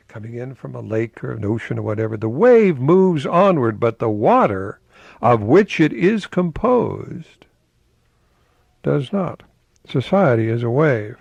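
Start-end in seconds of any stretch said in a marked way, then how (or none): 2.2-11.2 higher, slow and louder; emphatic repeat. Acted. Host repeating the quote to make viewers think. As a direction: savor each phrase as you repeat it.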